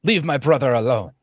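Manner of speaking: fast, high, loud protest; yelled plea. Acted. Brown captive defending his brother, fast high yell. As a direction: cry out in your brother's defence. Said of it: "The brown one then yells out".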